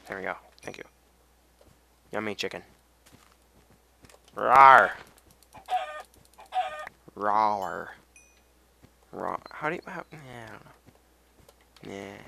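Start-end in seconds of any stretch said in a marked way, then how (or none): 4.4-4.9 loud, hammed-up monster rawr; playful rawr. Playful roar at chicken. 7.2-7.9 a smaller, half-hearted mock rawr. Repeats roar more softly.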